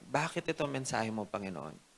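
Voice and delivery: soft retold question, sinking away; hushed, understated appeal to God. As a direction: ask the Lord quietly, voice dropping at the end. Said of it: "tinanong ang Panginoon".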